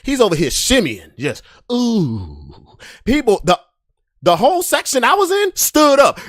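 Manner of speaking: normal talking voice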